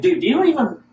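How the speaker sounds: high loud burst of excitement; excited. Acted. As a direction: rush it out thrilled. Excited phone rant.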